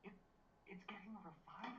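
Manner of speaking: whispering. Real, not acted. Whispered news to friends.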